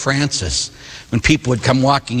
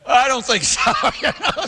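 laughing; 10 semitones higher than usual. Real, not acted. Laughing at the night visitor.

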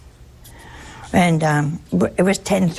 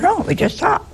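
high insistent reply; 11 semitones higher than usual. Retold or imitated. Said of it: "I said".

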